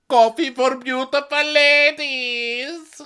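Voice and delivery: very high, loud, drawn-out call; hammed-up imitation, sing it out slowly; mock suave suitor. Imitating the Italian offering coffee, high loud drawl.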